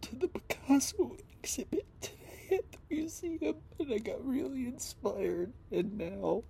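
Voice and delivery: holding back tears as it comes out; real upset, pitched far up; voice breaking. Real, not acted. Great day ruined, upset.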